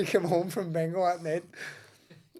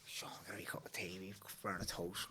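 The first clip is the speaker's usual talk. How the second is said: hushed, unvoiced stoned mumble; stoned whisper; 7 semitones lower, 18 dB quieter than usual. Brother asking for tea while stoned, whispered.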